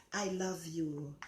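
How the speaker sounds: soft and slow, said tenderly; tender I love you. Shown as a demonstration. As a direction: murmur it gently to someone you love. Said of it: "just use the word to someone, say".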